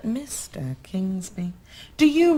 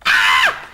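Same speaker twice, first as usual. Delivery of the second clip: triumphant gasp; 9 dB louder than usual. Acted. Snatches paper back, exclaims.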